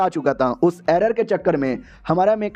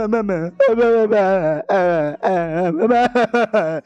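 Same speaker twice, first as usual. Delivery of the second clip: hammed-up sobbing on each word; mock crying; much slower than usual. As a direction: stretch every word like a fake crybaby. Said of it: "रोने लग जाता है".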